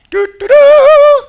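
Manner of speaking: high, loud, slow trumpet call sung out; mock trumpet fanfare. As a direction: blast a herald's fanfare.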